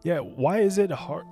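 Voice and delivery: usual speaking voice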